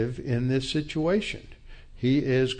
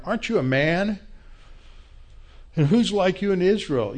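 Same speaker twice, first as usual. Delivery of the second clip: raised, higher voice taunting the general; calling out; 7 semitones higher, faster than usual. Acted. David shouting across the valley at Abner, calling out.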